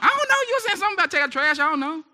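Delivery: high-pitched, rushed protest of ignorance; mock-helpless high voice; clueless husband. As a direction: play a hapless husband squeaking out his excuse. Acting out a husband caught forgetful.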